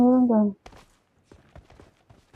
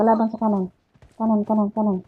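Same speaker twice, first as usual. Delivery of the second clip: urgent enemy warning; combat callout; 5 dB louder than usual. Real, not acted. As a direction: call the enemy direction fast.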